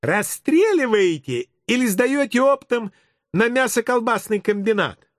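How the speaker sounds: put-on high-pitched kid voice; inquisitive child. Child quizzing the policeman about arrests, high kid voice. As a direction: pipe up like a nosy preschooler.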